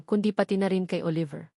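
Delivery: ordinary delivery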